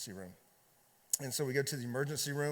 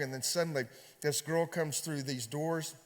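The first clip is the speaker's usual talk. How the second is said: ordinary delivery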